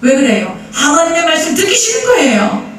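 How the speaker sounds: high, sing-song sermon cry; fervent preaching. Acted. Pastor warning the congregation, high sing-song preaching. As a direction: call out to the flock in rising fervour.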